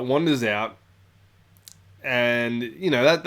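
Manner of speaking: ordinary talk, nothing marked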